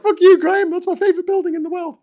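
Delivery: high, loud mock-offended viewer; upset viewer. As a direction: squeal it like an offended fan. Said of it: "They're like".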